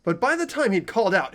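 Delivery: ordinary talk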